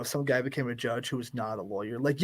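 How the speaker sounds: normal talking voice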